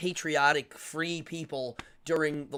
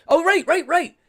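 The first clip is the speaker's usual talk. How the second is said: much higher and louder rights; light up in recognition; excited recognition; 13 semitones higher, 11 dB louder than usual. Real, not acted. Host recognizing the Hoover comment, excited and much louder.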